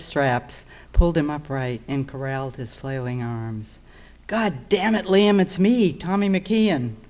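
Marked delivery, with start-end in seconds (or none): 4.3-7.0 loud, raised-pitch urgent protest; acted loud, exasperated outburst; exasperated shout. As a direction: call out to make him recognize you. Intruder identifies himself during a scuffle.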